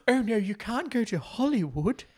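high, theatrical put-on voice, laughing through it; mock Eve. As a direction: camp up Eve's pleading warning. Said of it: "And Eve's always".

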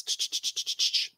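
quick repeated voiceless "ch" bursts; imitated hissing brake sound to cut someone off; shushing noise. Coach stopping an angry client mid-rant, shushing noise.

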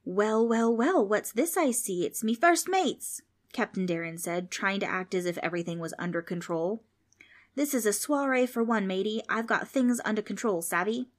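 a lightly voiced swaggering pirate accent; boy faking a cocky pirate accent; mild mock pirate captain accent, bluffing calm. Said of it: "drop the accent".

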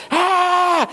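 a sudden high yelp, imitating the daughter; mock yelp. Dad imitating his daughter's high startled yelp.

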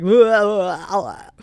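loud burst of "blah, blah" then falling back down; incoherent babble. Retold or imitated. Too exhausted to speak coherently, loud babbling blah blah. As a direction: blurt nonsense loudly, then fade. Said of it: "I'm just like".

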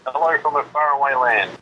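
tinny phone line sound; phone speaker. Real, not acted. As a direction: voice over a speakerphone. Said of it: "I'm just gonna put you on speakerphone".

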